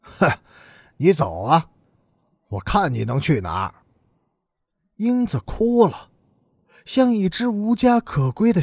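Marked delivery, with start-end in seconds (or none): 0.0-3.7 mild scornful taunt, dropping low at the end; a touch of cold, sneering challenge. A narrator's reading. Older brother daring the girl to leave, coldly sneering. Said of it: "贺老大冷笑着拉开屋门说".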